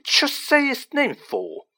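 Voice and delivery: high, drawn-out yelp; slow, high-pitched protest. A narrator's reading. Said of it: "yelped Stan".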